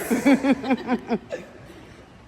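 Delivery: laughing at the word tumors; loud laugh. Real, not acted.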